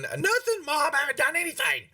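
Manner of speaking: put-on high, protesting kid voice; squeaky denial to Mom; little boy. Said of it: "a small, younger little boy".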